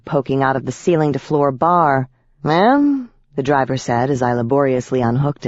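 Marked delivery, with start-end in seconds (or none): 2.4-3.1 high questioning call; a lightly voiced driver's call. A narrator's reading. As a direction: call to her politely from the seat. Bus driver calls to her.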